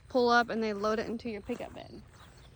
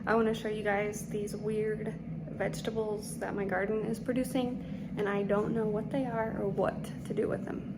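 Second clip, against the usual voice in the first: whispering; 8 dB quieter than usual. Real, not acted. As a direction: keep it soft and breathy, whispering. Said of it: "I'm whispering 'cause the kids are asleep".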